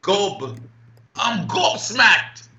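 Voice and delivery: put-on high, drawn-out British accent; hammed-up British accent, pitched high. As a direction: stretch it out slowly in a showy British accent. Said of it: "your accent is brilliant".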